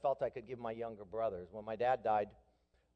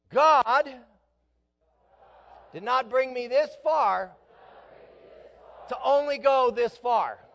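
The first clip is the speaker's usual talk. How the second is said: much louder and higher, slow and proclaimed; shouted declaration; 9 semitones higher, 10 dB louder than usual. Shown as a demonstration. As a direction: cry it out to the church. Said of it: "Repeat that when it gets hard".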